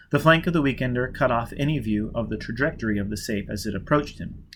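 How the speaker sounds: ordinary delivery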